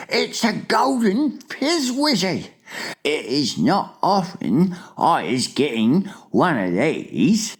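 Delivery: high, drawn-out giant voice; delighted giant. A narrator's reading. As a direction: gush over a rare prize. Giant rejoices over rare catch.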